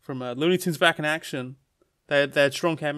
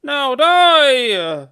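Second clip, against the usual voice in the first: high, loud villainous death threat; angry yell; 11 semitones higher, 9 dB louder than usual. Acted. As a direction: yell it furiously, drawing out the last word. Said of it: "we look angrily at Luigi".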